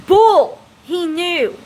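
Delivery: high, indignant outburst; angry disbelief. Real, not acted. Rejecting the claim that he didn't know, indignant. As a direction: cut the excuse down with an exclamation.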